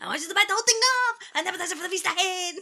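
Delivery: high, fast cartoon Mexican accent, laughing; Speedy Gonzalez. Co-host requested a redo, fast high cartoon Mexican accent. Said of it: "you sounded very Speedy Gonzalez".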